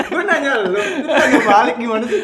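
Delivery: high, loud laughing complaint; laughing protest. Real, not acted. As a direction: protest while cracking up. Laughing protest at being asked back. (protes sambil tertawa)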